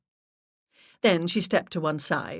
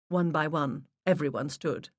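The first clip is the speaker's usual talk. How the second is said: usual speaking voice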